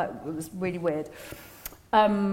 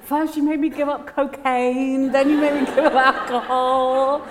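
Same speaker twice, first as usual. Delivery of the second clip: laughing complaint; 5 semitones higher, 6 dB louder than usual. Retold or imitated.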